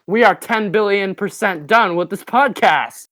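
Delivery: loud, slow anime catchphrase; Senku. Acted. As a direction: proclaim it loudly like an anime hero. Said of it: "this is Senku".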